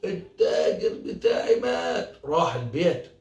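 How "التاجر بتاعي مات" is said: high, cracked mock wail; screaming for help. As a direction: cry out bad news in a put-on panic. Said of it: "فضل يصرخ يصرخ يصرخ".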